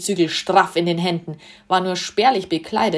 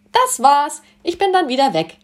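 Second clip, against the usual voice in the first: much higher, louder, sing-song goodbye; cheery farewell; 7 semitones higher, 5 dB louder than usual. A narrator's reading. Sun god bids farewell to the group, high and cheery. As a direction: wave them off brightly as you leave.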